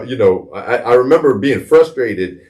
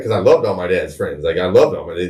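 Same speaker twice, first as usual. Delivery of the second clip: normal talking voice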